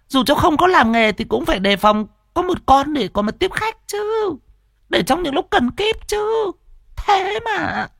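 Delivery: a nagging wife rubbing it in; teasing nag. Acted. Wife mocks husband for keeping no dog, scolding. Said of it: "thì trêu chéo".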